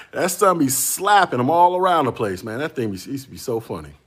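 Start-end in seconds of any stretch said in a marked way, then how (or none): 0.1-2.4 excited. Real, not acted. Laughing punchline.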